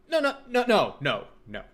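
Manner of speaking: sudden loud burst of no; loud, high, emphatic refusal; excited. Real, not acted.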